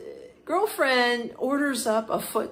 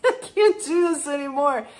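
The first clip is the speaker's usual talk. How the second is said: laughing; 6 semitones higher, 6 dB louder than usual. Retold or imitated. Girlfriend fed up, laughing retelling.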